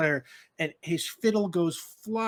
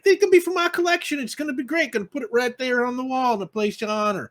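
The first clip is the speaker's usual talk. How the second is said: much higher and louder, thrilled about his new picture; excited gush; 8 semitones higher, 6 dB louder than usual. Acted. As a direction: gush with pride over the portrait. Excited keeper about photo.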